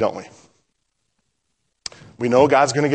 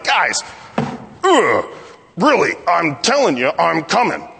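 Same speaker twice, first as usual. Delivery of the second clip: mock exasperation, higher pitch with a groan; exasperated; 5 semitones higher than usual. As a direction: plead in mild frustration, as if nobody listens. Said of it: "maybe he's mad at these guys".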